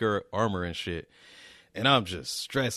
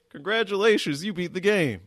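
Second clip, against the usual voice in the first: mock game announcer; 9 semitones higher than usual.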